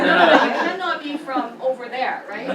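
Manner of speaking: loud, high mock vendor through laughter; retelling the flower vendor's disbelief, laughing; laughing imitation. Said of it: "he's saying".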